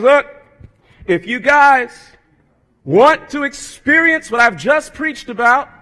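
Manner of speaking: Jesus thundering to the crowd by the sea. Pretend. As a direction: boom it down from the mountain. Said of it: "his voice thundering down".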